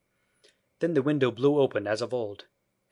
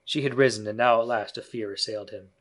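usual speaking voice

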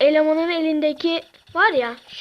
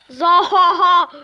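gloating laugh; slower than usual. Acted. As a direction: cackle at the victim. (kötü adam gibi kahkaha)

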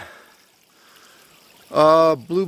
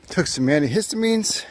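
ordinary talk

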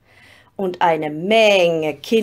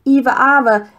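louder, eager shout of a name; children shouting; 5 semitones higher, 9 dB louder than usual. A narrator's reading. Some children shouting out their story choice. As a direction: yell the name like excited kids. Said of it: "schrien einige".

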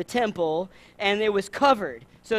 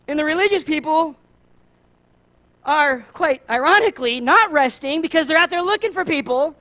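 higher and louder, building to an outcry; rising indignation; 8 semitones higher, 8 dB louder than usual. Real, not acted. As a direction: push the outrage up to the last line.

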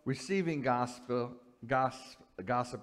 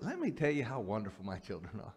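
low, soft mock brag; mock parental boasting; 5 semitones lower, 6 dB quieter than usual. Parent bragging on the phone, softly self-satisfied. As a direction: confide a boast about the children.

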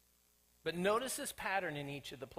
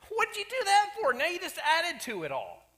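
much higher, loud, fed-up scolding; exasperated shout; 11 semitones higher, 7 dB louder than usual. Acted. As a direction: chew out the useless magicians. Pharaoh scolding his magicians.

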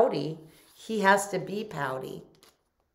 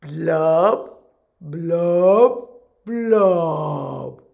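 loud fish blub chant; gurgling fish man croak; 9 dB louder, much slower than usual. Shown as a demonstration. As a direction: blub it out for kids to copy. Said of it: "Can you say that with me?".

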